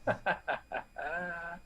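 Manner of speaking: string of put-on ha-ha-has; character's laugh. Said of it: "Amano laughs".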